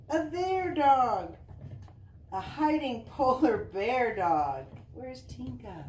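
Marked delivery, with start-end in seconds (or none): none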